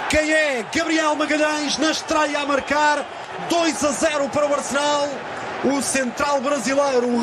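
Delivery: rising into a full goal roar; goal commentary shout. Real, not acted. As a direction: call the goal with everything. Goal called live, Portuguese commentary.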